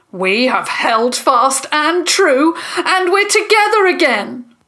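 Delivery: proud, loud declaration; rallying declaration. A narrator's reading. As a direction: join the chant, building in pitch. Joel joins the shouted chant.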